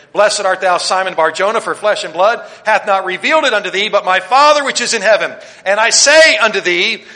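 loud, high pulpit proclamation; preaching at full force. Acted. Preacher booming Jesus' words to the congregation, loud and high. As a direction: thunder the words of Jesus out to the church.

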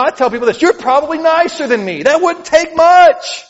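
high, loud preacher's build to a peak; fiery preaching. Acted. Pastor preaching hope to the congregation, at full force. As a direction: preach it hard, climbing to the peak.

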